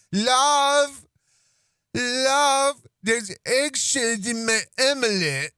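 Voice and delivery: high goofy voice, calling; silly put-on voice. Husband calling to his wife about eggshells, goofy high voice. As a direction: call out to her in the odd voice. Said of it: "I went".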